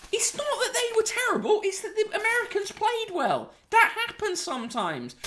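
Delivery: high, whiny squeal of complaint; mock-tearful protest. Host wails over the Ryder Cup defeat, mock-tearful protest. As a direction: protest in a squeaky, half-laughing wail.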